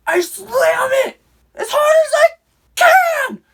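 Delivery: high, loud strained push; straining effort. Retold or imitated. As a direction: put your whole body into it. Slamming door with all might.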